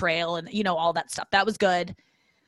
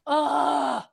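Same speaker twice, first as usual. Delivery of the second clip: a higher, put-upon grunt on arrival; exasperated ugh; 7 semitones higher than usual. Retold or imitated. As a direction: groan like you just dropped your bag after a long day. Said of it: "That was her impression of me".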